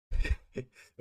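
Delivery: burst of laughter. Real, not acted. Laugh after loud remark.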